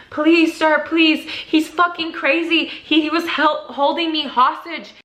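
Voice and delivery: high-pitched, imitated crying plea; tearful pleading. Begging the cop for help, tearful pleading. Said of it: "I start crying and saying".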